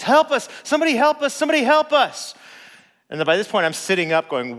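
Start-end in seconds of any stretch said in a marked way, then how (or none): 0.0-2.3 high, loud panicked yelling; woman next door screaming for help, loud and high; retold cry for help. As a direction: shout for help as if terrified. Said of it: "we heard this woman screaming".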